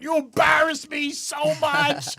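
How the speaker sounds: high, slow, loud mock shout; hammed-up teen yelling at his mom; embarrassed outburst. Teen in the car yelling at mom, hammed-up. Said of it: "I was in the car going".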